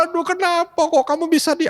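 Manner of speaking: a mother's high-pitched dismay at the firing; stressed mother. Retold or imitated. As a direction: play the panicked mom scolding her son. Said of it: "itu pasti stres dia".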